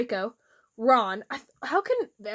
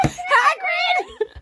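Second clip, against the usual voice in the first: high startled shout of the name; 5 dB louder than usual. Pretend. Friend yelps the name back in a high shout. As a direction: yelp it out, way up high.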